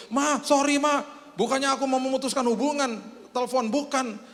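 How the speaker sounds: very high, astonished pleading; acted breathless amazement, high-pitched; overwrought apology. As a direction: pour it out to mother, voice way up high. Acted astonished child phoning mother back.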